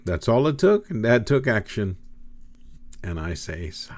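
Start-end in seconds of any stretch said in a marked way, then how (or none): 3.7-3.9 a wry whispered aside; whispered sigh. Pretend. Preacher to congregation, a whispered resigned "Sigh". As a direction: breathe the word out in a whisper.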